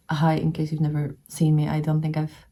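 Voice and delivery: usual speaking voice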